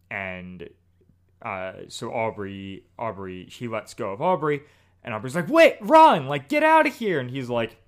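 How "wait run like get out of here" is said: high, loud frantic yell; panicked shout. Retold or imitated. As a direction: scream at him to run. Aubrey urging Wit to flee.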